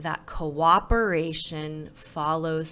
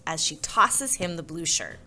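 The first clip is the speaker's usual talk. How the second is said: ordinary delivery, nothing marked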